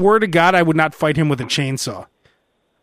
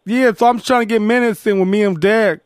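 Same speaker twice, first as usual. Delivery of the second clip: Kirk Heinrich impression; 3 semitones higher than usual.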